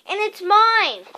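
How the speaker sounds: loud, high and slow insistence; furious. A narrator's reading. Harry insists to Uncle Vernon, furious. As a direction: insist hotly it's yours. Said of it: "said Harry furiously".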